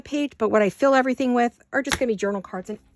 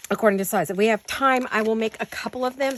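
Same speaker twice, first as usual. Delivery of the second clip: usual speaking voice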